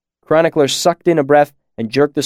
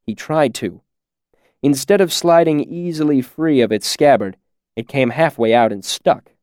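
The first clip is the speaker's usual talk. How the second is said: ordinary delivery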